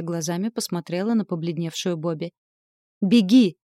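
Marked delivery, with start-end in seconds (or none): 3.0-3.6 much louder, sudden urgent cry; urgent shout. A narrator's reading. She warns her friend to run, shouting. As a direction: cry it out as a warning. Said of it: "крикнула она".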